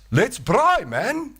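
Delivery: high hearty call; caveman translating. Acted. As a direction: translate with the same high cheer. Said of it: "that caveman said".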